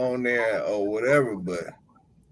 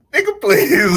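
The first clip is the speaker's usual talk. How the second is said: loud, higher, scoffing retort; dismissive shout; 8 semitones higher, 10 dB louder than usual. Retold or imitated. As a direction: blow them off loudly. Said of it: "I'll be like".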